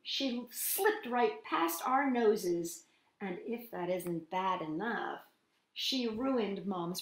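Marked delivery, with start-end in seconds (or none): none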